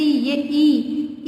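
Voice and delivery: ई held very long and slow; long ee sound. Shown as a demonstration. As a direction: stretch the vowel ई out slowly for the class. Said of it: "ई का उच्चारण है यहाँ पर बड़ी ई का". (बड़ी ई को बहुत लंबा खींचकर)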